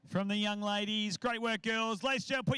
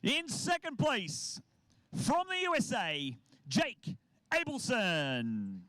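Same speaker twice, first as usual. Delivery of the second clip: announcer; 3 semitones higher than usual. Acted. Announces award winners.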